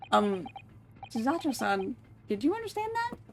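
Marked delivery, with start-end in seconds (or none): 2.3-3.2 high companion voice. Acted. High voice question.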